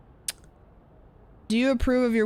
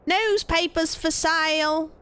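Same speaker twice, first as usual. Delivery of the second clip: high, drawn-out British paperboy cry; small British child hawking papers, slow and pitched up; British newsboy; 8 semitones higher, slower than usual. Pretend. Streamer imitating a page boy selling papers, mock British child. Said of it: "small child with, like, page boy hat".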